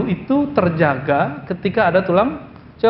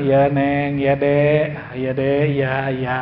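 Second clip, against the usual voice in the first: lower, gentle giving-in voice; Umar soothing his wife; 6 semitones lower, much slower than usual. Retold or imitated. Umar yields to his angry wife, gentle and low. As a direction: Umar meekly calming his scolding wife. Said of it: "Umar bilang".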